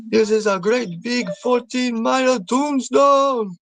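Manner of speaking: put-on slow, high, drawn-out Jamaican accent. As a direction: quote the movie in a big, slow Jamaican accent. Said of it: "really Jamaican with that accent".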